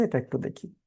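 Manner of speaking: ordinary talk, nothing marked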